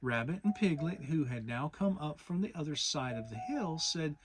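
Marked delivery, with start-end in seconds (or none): none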